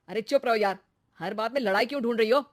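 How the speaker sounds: loud, fast irritated rebuke; angry snap. Acted. Husband telling quarrelsome wife to shut up, angry. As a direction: shut her down in exasperation.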